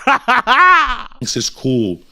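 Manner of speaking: high, slow, laughing through the words; can't stop laughing as you say it; cracking up mid-sentence. Real, not acted.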